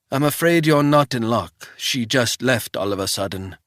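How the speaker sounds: put-on wary old housekeeper in a thick Swiss accent; old housekeeper questioning a stranger, thick Swiss accent. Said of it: "in a thick Swiss accent".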